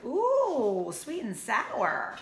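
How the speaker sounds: pained but greedy wolf; wolf cry. Acted. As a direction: yelp then relish it. And cried.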